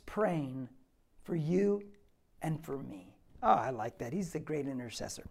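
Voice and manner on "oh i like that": ordinary delivery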